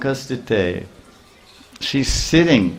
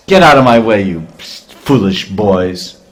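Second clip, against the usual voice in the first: angry dismissal; 6 dB louder than usual. Acted. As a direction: shove them aside with the words. Washerman drives the boys off.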